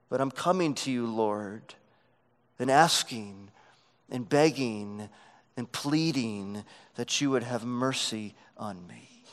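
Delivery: a mother softly begging the Lord; humble begging. Retold or imitated. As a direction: drop to a humble, quiet plea. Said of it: "what she is saying is".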